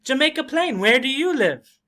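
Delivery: high, eager self-introduction; volunteer it brightly, then ask back; outgoing person. Acted. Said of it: "outgoing is more like giving information".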